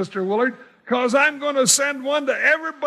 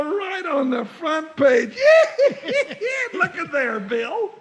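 delighted laughing; 4 semitones higher than usual. Acted. Jingles delighted by photo.

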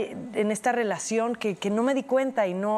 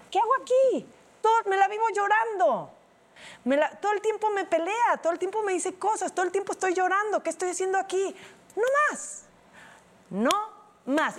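distressed outburst; 9 semitones higher than usual. Retold or imitated. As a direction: let it spill out at the breaking point. Her own breaking-point outburst retold. (desesperada, mucho más aguda y fuerte)

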